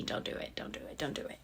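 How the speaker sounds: soft unvoiced whisper; hushed repeated warning; inner voice whisper. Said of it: "little voice in my head telling me".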